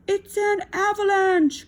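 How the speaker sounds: high, loud panicked cry; high loud warning cry of an avalanche; alarmed cry. A narrator's reading. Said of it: "cried Toad".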